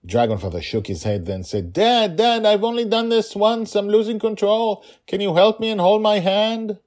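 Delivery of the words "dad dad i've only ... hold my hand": high, pleading plea for help; scared child calling to her dad. Acted. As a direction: ask for a hand while falling.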